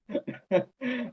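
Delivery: a quick soft chuckle after the joke; short laugh. Real, not acted. Teacher to students about the error, a quick soft chuckle. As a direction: laugh lightly at the student's mistake.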